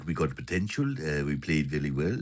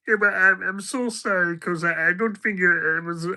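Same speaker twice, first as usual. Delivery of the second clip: much higher mock voice of an apologetic mate; hammed-up sorry friend, pitched up; Patter getting sensitive; 11 semitones higher, 4 dB louder than usual. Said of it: "Now I'll do Patter getting sensitive".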